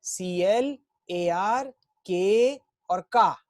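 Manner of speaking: slow, spaced letters; slow spelled letters. Shown as a demonstration. Teacher spelling out the last letters slowly. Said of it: "क्या बोलना है".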